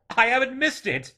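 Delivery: high, loud, elated exclaiming; breaking into jubilant relief; thrilled relief. A narrator's reading. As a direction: marvel aloud, overjoyed. Scrooge thrilled he hasn't missed Christmas.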